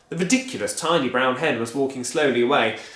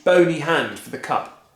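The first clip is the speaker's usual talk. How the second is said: ordinary delivery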